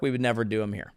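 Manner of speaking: low muttered aside; quiet low throwaway; dropped, soft. Shown as a demonstration. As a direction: say it under your breath. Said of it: "first take, dropped low".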